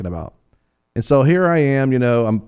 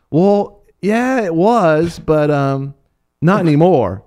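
put-on little girl voice; 7 semitones higher, 4 dB louder, much slower than usual. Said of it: "this eight-year-old girl says".

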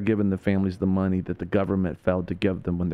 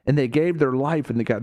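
ordinary talk

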